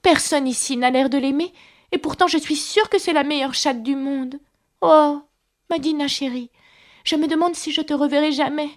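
put-on high, plaintive little-girl lament; melancholy. A narrator's reading. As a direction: miss a beloved cat, sorrowfully. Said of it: "d'une voix mélancolique". (plainte mélancolique, voix aiguë)

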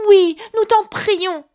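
loud, high, drawn-out plea; slow imploring call, as if from a whole group; chorus of exclamation. A narrator's reading. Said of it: "s'exclamèrent en chœur". (supplication lancée en chœur)

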